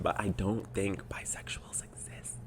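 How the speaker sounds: hushed, voiceless confession; conspiratorial whisper. Real, not acted. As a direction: whisper it like a forbidden opinion. Confesses opinion expecting to get flamed.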